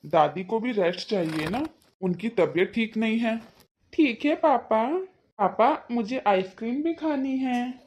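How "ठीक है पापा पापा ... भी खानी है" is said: put-on a small kid asking papa for ice cream; little boy. Little son talking to father. (छोटे बच्चे की आवाज़)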